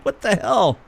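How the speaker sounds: high mock disbelief; incredulous. Host imitating his own reaction to the pitcher, high disbelief. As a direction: throw up your hands in disbelief. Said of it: "I just am like".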